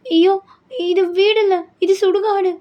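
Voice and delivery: high-pitched dismayed outcry; alarmed bride. Acted. Bride seeing a graveyard instead of home, alarmed.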